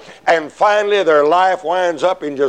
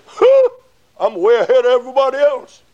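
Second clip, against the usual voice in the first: high, fast self-satisfied scoff; smug boast; 11 semitones higher than usual. Retold or imitated. Backslider boasting he's ahead of others, high smug scoff. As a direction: scoff proudly at everyone else. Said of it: "you'll say".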